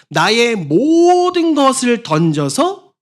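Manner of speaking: loud, slow, high straining cry; slow forceful preacher's cry; all-out cry. Shown as a demonstration. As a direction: throw everything into the shout. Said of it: "악을 쓴다고 말하죠".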